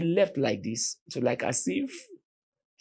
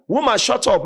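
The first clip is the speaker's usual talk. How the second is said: higher, louder barked order; armed robber shouting at mother; robber's shout; 8 semitones higher, 6 dB louder than usual. Retold or imitated. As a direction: silence her with a yell.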